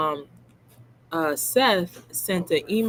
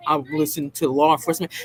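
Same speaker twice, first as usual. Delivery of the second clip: lower, sneering quote of him; mocking voice; 4 semitones lower, faster than usual. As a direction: repeat his line in a taunting voice. Mocking Chris's comment in a lower voice.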